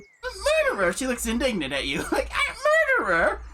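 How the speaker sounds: put-on high, offended old lady with a laugh; indignant granny voice. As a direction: scoff like an insulted old woman.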